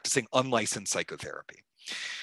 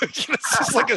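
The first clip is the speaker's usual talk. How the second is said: laughing; 12 semitones higher, 6 dB louder than usual. Real, not acted. Laughing about difficult Lee.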